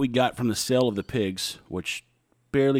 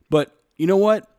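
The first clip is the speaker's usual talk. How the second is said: higher, louder, punching the word "what"; pointed turn; 9 semitones higher, 7 dB louder than usual. Real, not acted. Turning to address the TSA listeners, with a pointed challenge. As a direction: spin it round like a challenge. Turns to rant about TSA.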